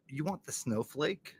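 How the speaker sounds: ordinary delivery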